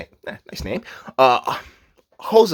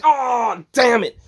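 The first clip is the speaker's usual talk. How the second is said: high, louder mock outburst; retold exclamation; 11 semitones higher, 4 dB louder, much slower than usual. Host retelling lifting an arm that morning, retold exclamation. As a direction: draw out the oh, then exclaim.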